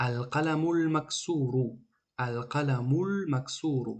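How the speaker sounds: slow "Ал-қаламул максуру", words joined through the л; deliberate, connected "Ал-қаламул максуру"; linked reading demo. Shown as a demonstration. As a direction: stretch Ал-қаламул максуру slowly without breaking between the words. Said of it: "Баъди мим зада мекунем дар алифлом".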